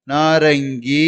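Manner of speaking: நாரங்கி drawn out slowly with nasal ng; correct pronunciation. Shown as a demonstration. Teacher models the word for learners, slow nasal ng. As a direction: stretch நாரங்கி, long final ee, ng clearly sounded. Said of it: "அப்படின்னு நீங்க உச்சரிக்கணும்".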